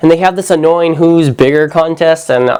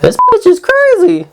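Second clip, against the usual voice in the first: much higher mocking voice, thrown away; high mock-outraged aside from the whole room. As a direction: squeak it out like a scandalised crowd. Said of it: "everyone's just like".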